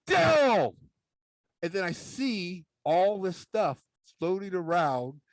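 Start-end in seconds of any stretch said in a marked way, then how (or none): 0.1-0.7 put-on high yell of a startled kid; retold startled shout; mock yell. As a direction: yell out the brother's name in alarm. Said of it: "And I yell".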